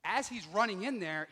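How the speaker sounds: ordinary talk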